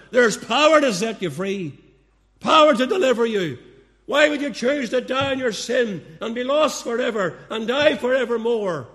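rising preaching. Real, not acted. Preacher urging sinners to be freed, loud fervent preaching. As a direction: lift it like a revival preacher.

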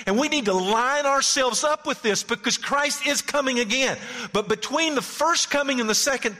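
loud, high preaching voice. Acted. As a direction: preach it out with fire. Preaching at full force.

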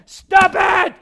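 high, very loud parental outburst; exasperated shout. Acted. Parent cutting off a nagging family, exasperated shout. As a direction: explode at relentless nagging.

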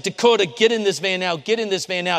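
high, loud urgent order; urgent shout. Retold or imitated. Song leader shouting at Dakota to get in, loud and high. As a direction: yell it like the boy's slipping away. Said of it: "Tim was like".

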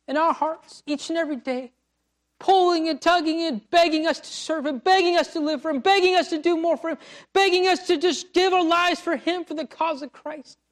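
fervent sermon crescendo; building preaching. Acted.

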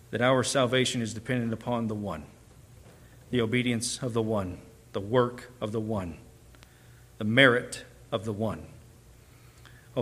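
low, measured pastoral prayer. Real, not acted. Leads opening prayer.

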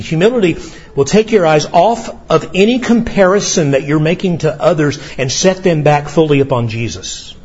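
usual speaking voice

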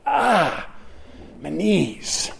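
acted achy groan on rising; mock pained groan. Preacher imitating someone getting out of a chair, playful groan. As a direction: groan lightly as stiff joints complain. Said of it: "That's the groan".

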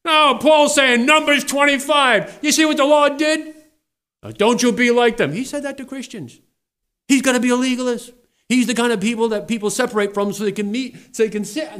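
high, loud, hammering sermon voice; thundering revival preacher; fiery preaching. Acted. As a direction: preach it at full force to the congregation. Preacher at full force.

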